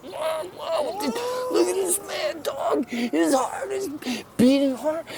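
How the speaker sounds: very high astonished whoa; amazed crowd. Acted. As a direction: gasp it out in awe. Crowd freaks out.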